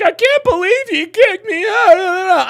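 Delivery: high, drawn-out whiny wail mocking her; mock crying. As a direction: imitate her bawling, loud and exaggerated. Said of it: "I made that stupid hoe cry".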